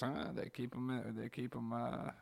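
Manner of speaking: put-on quiet, halting mumble of an old scientist; Doc Brown impression. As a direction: trail off under your breath like Doc. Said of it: "He goes".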